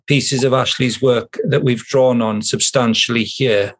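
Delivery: normal talking voice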